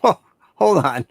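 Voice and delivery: ordinary talk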